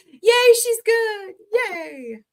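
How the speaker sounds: high, drawn-out happy yay; excited cheer. Real, not acted. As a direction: cheer her on with a squeal. Cheering a friend's shout-out.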